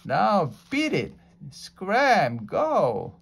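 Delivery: high, loud shooing; yelling. Acted. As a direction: chase it off with a shout. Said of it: "Jack yelled at it".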